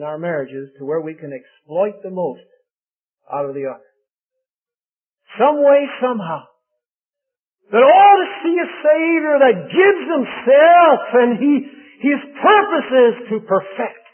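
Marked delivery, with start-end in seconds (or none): 5.4-14.0 building preaching. Real, not acted. Preacher stirring the husbands to a loud climax. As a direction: swell the voice to a climax.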